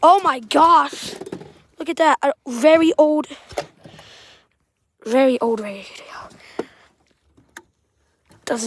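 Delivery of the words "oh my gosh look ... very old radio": amazed discovery. Real, not acted. Explorer spotting an old radio in wreckage, astonished. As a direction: exclaim at an unexpected find.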